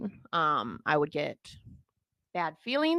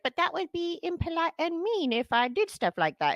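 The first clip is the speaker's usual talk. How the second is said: lightly voiced little girl, higher pitch; a hint of a polite little girl protesting; 10 semitones higher than usual. A girl objecting to her mom, lightly voiced childish protest. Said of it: "being a little girl".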